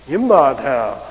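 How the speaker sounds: Yimba ta, voice going down through ta; falling tune. Shown as a demonstration. Teacher repeats the falling, doubting Yimba ta. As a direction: stress yimba and fall away. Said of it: "Hear my voice going down?".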